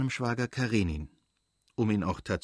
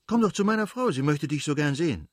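much higher and louder, bubbling over; eager invitation; 5 semitones higher, 4 dB louder than usual. Acted. Stepan urging his brother-in-law to visit, eager and much higher. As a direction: coax him warmly, all enthusiasm.

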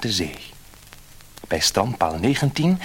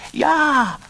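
eager fisherman; 6 dB louder than usual. A narrator's reading. Fisherman answers the fish.

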